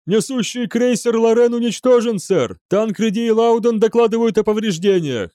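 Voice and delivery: high, urgent yell; shouted damage report. A narrator's reading. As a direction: report losses over battle din. Офицер докладывает о потерях в бою. (тревожно выкрикивать доклад)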